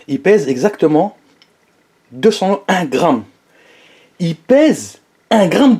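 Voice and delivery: slow, drawn-out announcement; suspenseful reveal. Pretend. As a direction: stretch out each word for the big reveal. Mock announcement of the weigh-in result.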